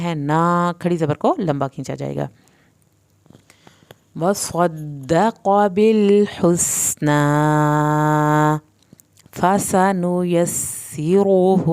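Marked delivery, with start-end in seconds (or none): 4.2-11.7 slow, drawn-out tajweed chant; Quranic recitation. Shown as a demonstration. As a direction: recite each word slowly, melodically.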